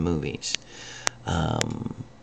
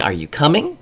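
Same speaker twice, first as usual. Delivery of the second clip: rising question on coming; neutral question; 7 semitones higher than usual. Shown as a demonstration. Teacher asking a neutral question, rising. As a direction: ask it openly, tune rising on "coming". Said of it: "the implication is".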